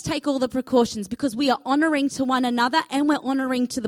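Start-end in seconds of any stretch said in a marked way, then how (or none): none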